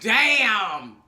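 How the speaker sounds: a punchy shouted damn; loud exclamation. Real, not acted. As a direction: burst out at the awkwardness. Reacting to the pity scene.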